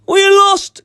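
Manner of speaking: very high, loud panicked wail; terrified cry. A narrator's reading. Eric's men crying out to each other as the dragon attacks, loud high panic. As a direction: cry out as if all hope is gone. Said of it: "cried Eric's men".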